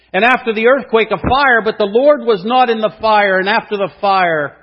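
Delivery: loud, high, rising sermon climax; preaching at full force. Acted. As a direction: drive each phrase harder like a storm. Builds the wind, earthquake, fire.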